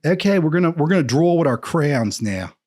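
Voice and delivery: put-on loud, high Philadelphia accent; Philly accent. Imitating people at elementary school, Philly accent. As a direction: mimic thick Philly schoolroom talk. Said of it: "I remember being a little boy in elementary school".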